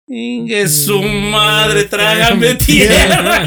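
embarrassed laughter, wishing the ground would swallow him; laughing. Real, not acted. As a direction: stretch it out while cracking up.

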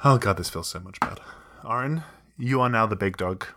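ordinary delivery

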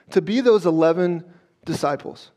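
ordinary talk

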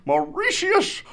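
put-on booming old-timey town crier. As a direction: proclaim it like a medieval herald. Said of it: "he turns around and he is like".

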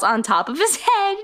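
ordinary talk, nothing marked

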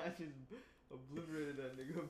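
laughing brag. Real, not acted.

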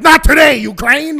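mock battle cry. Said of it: "We're like".

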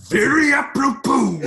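high, loud macho wrestler voice; hammed-up wrestling promo shout; Randy Savage. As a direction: belt it like a wrestler's catchphrase. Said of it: "most amazing phrase to say in".

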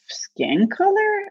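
skin color stretched high and slow; incredulous emphasis. Real, not acted. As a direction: lift and draw out skin color. Indignant about being chosen for color.

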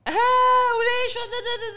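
very high, slow mocking shout; mock loud chatter. Imitating the girls talking again, very high and loud. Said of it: "they start talking".

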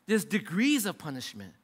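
ordinary talk

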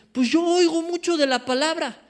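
a hint of a boastful listener; mock smug believer. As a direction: parody a churchgoer who only listens. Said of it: "cuando dices".

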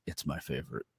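very soft, low retold aside; low mimicked mutter. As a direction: drop right down and murmur it. Retold remark mimicked low and quiet.

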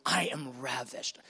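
low, soft declaration of love; tender voice of God. Acted. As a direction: answer doubt quietly, deep in the voice. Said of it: "He goes".

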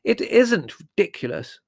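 high, loud, indignant denial; slow, emphatic angry retort. A narrator's reading. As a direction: contradict hotly, punching each word. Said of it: "said Margot angrily".

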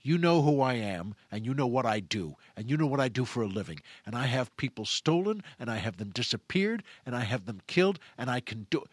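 low, controlled threat; acted cold-blooded villain; sadistic menace. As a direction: lay out the threat low and steady. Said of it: "he becomes some sadistic person".

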